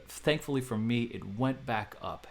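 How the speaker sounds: ordinary talk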